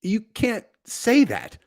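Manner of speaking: mock shocked objection. Voices shocked people objecting.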